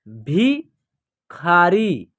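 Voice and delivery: भिखारी drawn out, syllables apart; slow syllable by syllable. Shown as a demonstration. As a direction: read भिखारी slowly in parts. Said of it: "या आप direct भी पढ़ सकते हैं". (भिखारी धीरे-धीरे टुकड़ों में)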